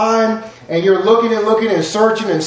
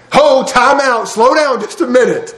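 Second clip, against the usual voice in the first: calling out ho; 6 semitones higher than usual. Pretend. Preacher voicing God's call.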